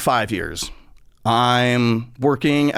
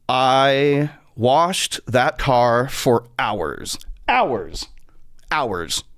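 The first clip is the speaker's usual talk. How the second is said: drawn out; much slower than usual. Real, not acted. Stretching how long he washed.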